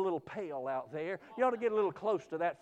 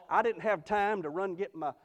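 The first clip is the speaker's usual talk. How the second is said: raised, driving preacher's delivery; fervent preaching; 3 semitones higher, 4 dB louder than usual. Real, not acted. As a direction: pour out scripture in an urgent pulpit cadence. Preaches scripture at full voice.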